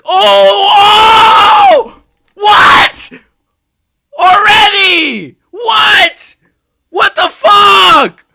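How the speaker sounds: hoarse, high, full-throated shouting in disbelief; screaming fan celebrating a first-round finish; ecstatic screaming. Real, not acted. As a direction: lose your mind cheering a knockout. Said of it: "You can tell I'm excited 'cause my voice is".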